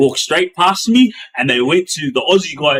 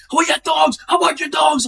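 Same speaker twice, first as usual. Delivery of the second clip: high, strained mock yell; imitating a choking inmate cursing; swearing while choking; 11 semitones higher than usual. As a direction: spit it out between coughs. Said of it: "he was swearing".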